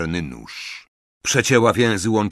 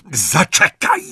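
higher, sharp interruption; 9 semitones higher, 4 dB louder than usual. A narrator's reading. Bellatrix halts Greyback and the prisoners, sharp. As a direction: stop them with a sharp command. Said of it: "powiedziała ostrym tonem Bellatrix".

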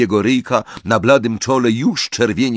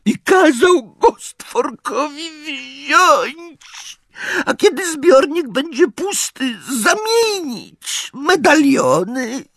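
very high, slow, crying elf voice; sobbing house-elf; slower than usual. A narrator's reading. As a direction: cry it out, squeaky and broken. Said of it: "Zaniósł się takim szlochem".

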